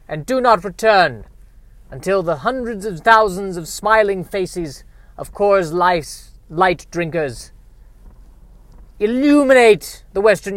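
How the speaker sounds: high, mock-heroic proclamation with dramatic pauses; grand rallying speech. Boss addressing Coors truck drivers, grand rallying speech. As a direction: send the minions forth like an epic leader. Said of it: "That should be their speech".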